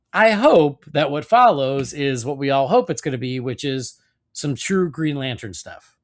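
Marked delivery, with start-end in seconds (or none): none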